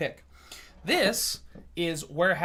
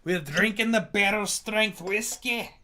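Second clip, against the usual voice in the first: put-on higher, drawn-out terrible Scottish accent; Fat Bastard; 4 semitones higher, 5 dB louder, slower than usual. Streamer toasting the whiskey, mock-Scottish movie character accent. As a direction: ham up a movie-Scotsman brogue. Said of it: "Just sound like Fat Bastard".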